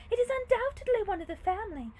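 high, loud noble command; crisp theatrical princess voice; resolute princess. A narrator's reading. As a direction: take charge as the lady. Princess instructs the maid.

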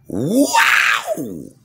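high, drawn-out whoop of amazement; hollered, sky-high exclamation; big wow. Acted. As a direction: stretch it out in a soaring cry. Exclaims to open the show.

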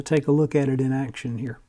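ordinary talk, nothing marked

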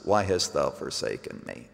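low, soft echo of the cry; hushed unanswered cry. Acted. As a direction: let it sink into the silence. Cry repeated after silence from heaven.

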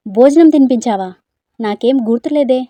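very loud disbelief; loud stunned denial; shocked loud accusation. Acted. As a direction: shout it at him, aghast. Accusing quarrel on waking.

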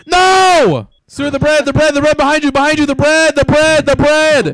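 loud, high, panicked yelling; panicked shouting. Real, not acted. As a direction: yell a frantic warning over and over. Warning player about burning bread.

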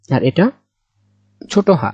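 usual speaking voice

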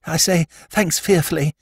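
put-on high, eager young toff; lord Drever voice. Young lord approaching Jimmy's table, high eager toff voice. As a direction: pitch it up like a flustered young aristocrat.

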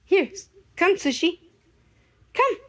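normal talking voice

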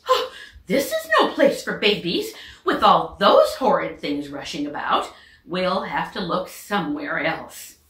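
catching her breath, rattled; flustered duck mother. Acted. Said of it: "when she got her breath".

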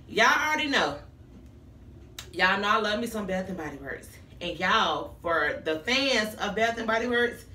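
ordinary talk

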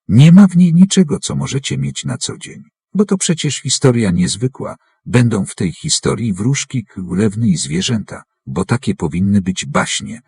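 ordinary talk, nothing marked

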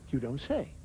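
low-pitched, understated English reply; murmured polite interest, pitched low with a lift; English understatement. Acted. English person answering news, low understated interest. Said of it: "The English also use expressions like".

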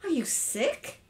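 concerned wife; anxious. A narrator's reading. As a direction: ask with gentle worry. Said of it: "looking anxiously at him".

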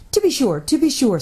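a nervous little faun probing gently; faun character voice. A narrator's reading. The faun politely quizzes the girl in a fussy, nervous voice.

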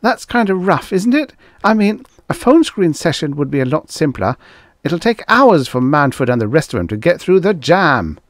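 high-pitched, protesting tone; disapproving. A narrator's reading. Assistant argues against the live meeting, disapproving. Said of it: "Frick frowned disapprovingly".

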